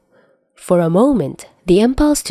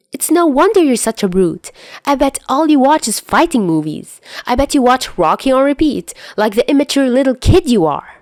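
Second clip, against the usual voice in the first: teasing scorn; 8 semitones higher than usual. Acted. Dazai mocking Chuya's taste in fighting movies, higher teasing scorn. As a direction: needle him like a smug older kid.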